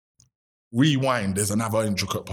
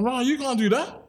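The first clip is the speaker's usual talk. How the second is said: higher, fast, indignant outburst; 11 semitones higher, faster than usual. Retold or imitated. To the manufacturer who broke his word, fast indignant outburst. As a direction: let him have it, fast and high.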